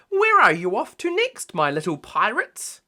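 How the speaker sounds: high, loud sweet granny voice; Granny Pig. A narrator's reading. Granny Pig asking the little pirates, high granny voice. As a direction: ask it fondly like a granny. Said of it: "asked Granny Pig".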